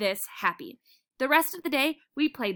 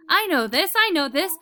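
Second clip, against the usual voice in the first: high, loud, bouncing excitement; can hardly sit still; dog certain he knows the command; eager; 6 semitones higher, 7 dB louder than usual. A narrator's reading. Eager dog before the treat.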